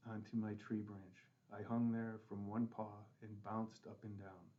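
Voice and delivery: ordinary delivery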